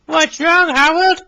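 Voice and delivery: high, worried little engine asking; concerned Billy. Acted. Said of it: "asked Billy".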